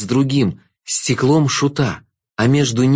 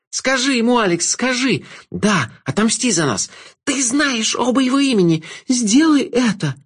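much higher, pleading, stress on "не"; a wronged woman begging for revenge; high, urgent woman's voice demanding execution; bitter woman Agnessa; 12 semitones higher than usual. A narrator's reading.

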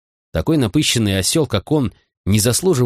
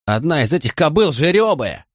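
higher-pitched objection; a protest in a raised pitch; 7 semitones higher than usual. A narrator's reading. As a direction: object with some urgency. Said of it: "возразил Хэнс".

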